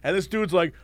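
ordinary delivery

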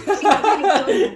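laughing loudly while talking. Real, not acted. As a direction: burst into laughter mid-line. (hablando entre risas)

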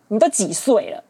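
ordinary talk

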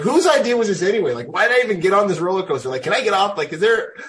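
high, fast panicky whine; hammed-up coaster terror; mock panic. Rider at the top of the coaster, fast mock panic. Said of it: "And you're like".

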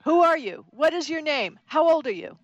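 high, rapid-fire questions; interrogating wife. Retold or imitated. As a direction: grill the stranger hard.

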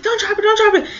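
high, urgent pleading, imitating the friend; panicked warning. Friend begging her not to drop the gun, high panicked plea.

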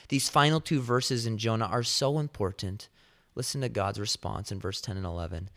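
usual speaking voice

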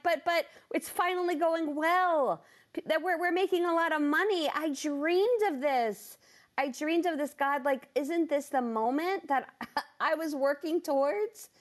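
high-pitched protest, words stretched on the big points; acted-out incredulous pleading. Protesting to God in retold talk.

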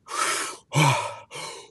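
fast, deep panting breaths; rapid deep breathing. Shown as a demonstration. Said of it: "as fast as you can like this".

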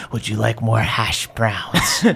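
slow, low creepy offer; Zelda. Acted. Zelda offers hash browns, slow creepy voice. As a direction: ask it slowly in a low, eerie voice. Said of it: "Zelda creepily looks at you guys".

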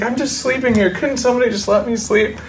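high, whiny mock protest; annoyed at being woken. Man woken on a plane aisle, high whiny protest. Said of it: "I'm like".

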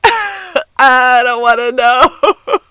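very slow, high, loud wail through laughter; squealing laughing protest, dragged out; laughing whine. Real, not acted. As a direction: plead through real laughter. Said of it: "I'm like".

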